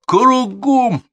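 very high, loud, stretched order; furious sergeant's yell; shouted drill command. A narrator's reading. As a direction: bellow it across the parade ground. Instructor barks drill order again.

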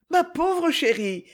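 high, slow distressed pity; stricken, tender concern; trembling voice. A narrator's reading. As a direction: draw it out in anxious sympathy. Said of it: "dit Isabelle d'une voix tremblante".

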